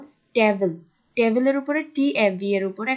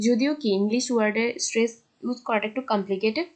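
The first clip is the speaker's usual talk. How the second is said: usual speaking voice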